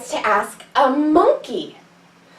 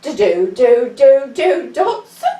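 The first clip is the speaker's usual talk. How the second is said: dodo call; 3 dB louder, slower than usual. Shown as a demonstration. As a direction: chant it like a proud little bird. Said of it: "and say".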